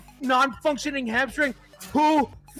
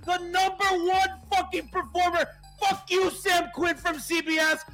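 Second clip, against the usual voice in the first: ecstatic shouting rant; 7 semitones higher than usual. Real, not acted. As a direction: scream it at every doubter. Victory rant at doubters.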